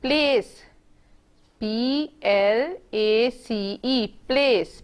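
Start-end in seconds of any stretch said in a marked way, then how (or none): 1.6-4.0 slow spelling. Shown as a demonstration. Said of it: "लफ्ज़ को खुद से पढ़ना सीखें".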